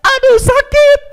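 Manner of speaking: high whiny mock complaint; mock whine. Preacher mocks Christians who cry out at insults, with a high whiny voice. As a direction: whine it like a self-pitying believer. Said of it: "Kalau dicaci maki teriak".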